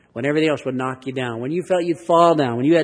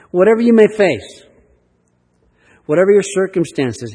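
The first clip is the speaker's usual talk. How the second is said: urgent appeal; 7 semitones higher, 8 dB louder than usual. Real, not acted. Urging hearers to take verse personally.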